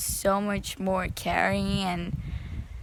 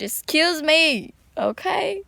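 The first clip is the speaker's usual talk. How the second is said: higher, louder, drawn-out excuse me; emotional outburst; 7 semitones higher, 6 dB louder than usual. Real, not acted. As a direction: blurt it out, overcome with feeling. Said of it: "I kinda got a little bit emotional".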